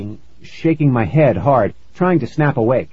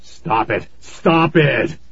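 dad shouting; 2 semitones higher, slower than usual. A narrator's reading. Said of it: "Dad shouted".

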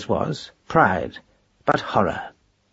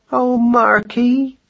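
much higher, slow wailing cry; 12 semitones higher, 3 dB louder, much slower than usual. A narrator's reading. Madeline crying out to Marcus, high and slow. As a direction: cry out to your husband. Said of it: "cried Madeline".

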